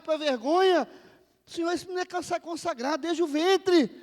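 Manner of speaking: high, loud crying out to God; fervent shouted supplication; impassioned preaching. Retold or imitated. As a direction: plead at full force in a re-enacted prayer. Preacher re-enacts his desperate prayer.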